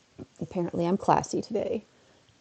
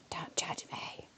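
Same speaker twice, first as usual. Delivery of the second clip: whisper; 15 dB quieter, slower than usual. Pretend. Repeated playful plea.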